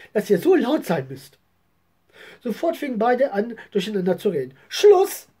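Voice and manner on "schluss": sharp high loud call to order; mother silencing her children, loud and stern; stern command. A narrator's reading. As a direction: stop them dead, mother in charge. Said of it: "sagte ihre Mutter und hob die Hand".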